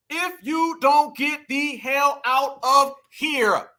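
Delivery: very slow, word by word; emphatic dismissal. Real, not acted. Vlogger dismissing the candidate to viewers, slow and emphatic. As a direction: space out every word in disbelief.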